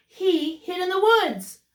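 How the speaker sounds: plain reading voice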